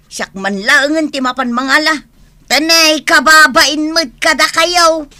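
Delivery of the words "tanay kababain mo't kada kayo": scolding. Acted. Mother shaming the kids.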